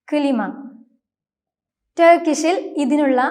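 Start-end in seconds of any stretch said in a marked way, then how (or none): 0.1-0.4 "കിലിമ" with a short vowel between k and l; correct Turkish pronunciation. Shown as a demonstration. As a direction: split "കിലിമ" into kı-li-ma. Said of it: "ഇ എന്ന letter ഉള്ളതുപോലെ".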